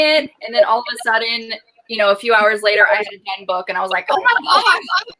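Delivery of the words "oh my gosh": high, drawn-out mock astonishment; amazed reaction. Reader finishing a book in hours, amazed. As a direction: marvel in a much higher voice.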